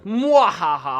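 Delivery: loud villain laugh; evil cackle. Pretend. Gleeful reaction to message.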